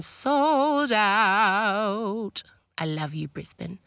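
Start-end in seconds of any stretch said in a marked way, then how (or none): 0.0-2.4 very slow, stretched proud announcement; drawn out. Real, not acted. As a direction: savour every word slowly.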